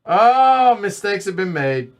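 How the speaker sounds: loud high dismayed oh; dramatic mock lament, high; excited planning. Real, not acted. Realizes a misplayed turn.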